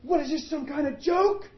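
high, loud furious outcry; angry scream. Retold or imitated. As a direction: hurl it at God like an accusation.

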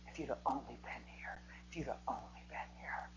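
breathy whisper, voice almost gone; grieving whisper. Acted. Mary whispering her grief to Jesus at the tomb, whispered. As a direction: whisper it like a grieving sister at his feet.

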